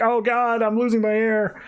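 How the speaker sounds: high, panicky yelp of losing air; mock panic as his helmet cracks; freaking out. Retelling, a helmet cracked, mock panic over losing air. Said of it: "he starts freaking out".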